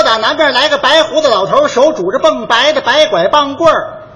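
略提高声调，更卖力地重念; 一字一字清楚地示范给他学; 再念一遍绕口令. Shown as a demonstration. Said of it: "再说一遍". (提高嗓门重念绕口令)

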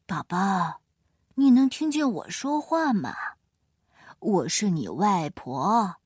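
慢悠悠拖长的外婆声; 像外婆一样慢慢哄宝宝. A narrator's reading. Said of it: "翠西太太的妈妈来了". (外婆慢声慢气地说话)

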